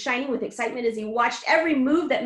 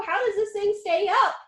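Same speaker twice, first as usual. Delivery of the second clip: anxious cry; 9 semitones higher, 4 dB louder than usual. A narrator's reading. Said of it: "cried Grandpa Joe".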